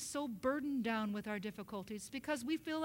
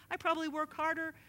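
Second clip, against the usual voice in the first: ordinary talk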